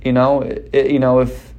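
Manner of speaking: ordinary talk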